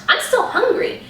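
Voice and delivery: high whiny imitation of a friend; high mock whine. Friend complaining she is still hungry, high mock whine. Friend quoted after dinner.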